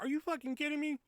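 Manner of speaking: high, incredulous question; retold disbelief. Disbelief at being featured again.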